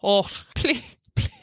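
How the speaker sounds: a stab at a Scottish beggar's plea; pleading in a shaky Scottish accent; mock Scottish begging, breaking off; attempted Scottish accent. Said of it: "Scottish accent".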